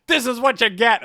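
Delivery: loud, high retold gloat; triumphant, hammed-up shout; vengeful shout. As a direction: yell it as mock payback. Retold vengeful moment in game.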